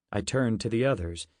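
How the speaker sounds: ordinary delivery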